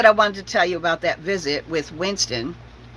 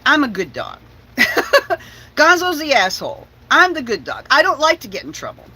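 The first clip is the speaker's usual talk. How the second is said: higher, louder grumbling dog voice; dog storming off after being blamed, huffy and raised; indignant dog; 5 semitones higher, 4 dB louder than usual. Retold or imitated. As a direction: complain like a wronged good boy. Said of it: "Winston's like".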